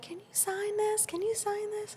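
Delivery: high, small eager voice; imitated autograph seekers; pleading fans. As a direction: beg for an autograph in a squeaky pitch.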